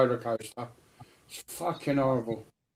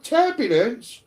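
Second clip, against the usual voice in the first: high, loud disbelieving echo of TURBULENCE; incredulous retort; 9 semitones higher, 5 dB louder, slower than usual. Retold or imitated. Child answering parents about turbulence, incredulous. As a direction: throw the word back in high-pitched disbelief. Retold outraged reply to parents.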